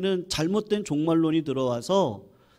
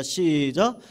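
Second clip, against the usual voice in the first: usual speaking voice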